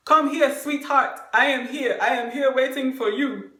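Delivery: hammed-up proud date calling out. Man calling his date across the restaurant, loud and proud.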